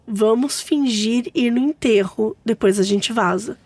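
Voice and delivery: ordinary talk, nothing marked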